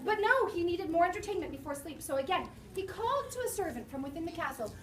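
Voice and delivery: ordinary delivery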